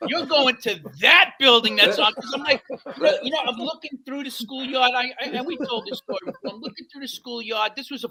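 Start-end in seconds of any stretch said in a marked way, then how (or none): 0.1-2.1 retold yell. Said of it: "screaming at me at the top of your lungs".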